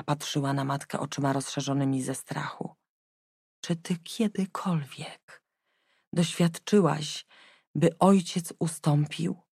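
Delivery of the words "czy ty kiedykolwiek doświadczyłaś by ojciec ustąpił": hoarse whisper. Acted. Frightened daughter asking mother, slow hoarse whisper. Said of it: "spytała zachrypniętym głosem".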